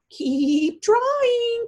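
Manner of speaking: high, loud encouraging call; cheerful call. Acted. Turtle calling up to the floating spider, high and loud. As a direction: cheer her on with mock kindness.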